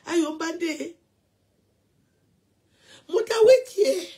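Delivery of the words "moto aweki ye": high, loud hammed-up cry; mock wail. Mocking the crying woman, in a high hammed-up wail. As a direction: let it out as a parody wail.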